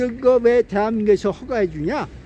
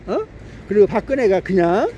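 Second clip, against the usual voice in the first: normal talking voice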